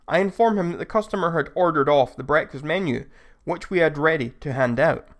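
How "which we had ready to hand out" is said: ordinary delivery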